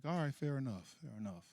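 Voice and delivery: very quiet, low resignation; meek surrender. Acted. Driver giving in to his carjacking passengers, meek and quiet. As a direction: give in meekly, voice dropping away.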